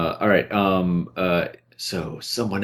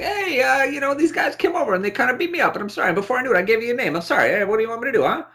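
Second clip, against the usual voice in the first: much higher, pleading fence voice; Jay Leno; faster than usual. Retold or imitated. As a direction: whine an apology as a shady fence. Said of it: "I don't know, Jay, Jay Leno".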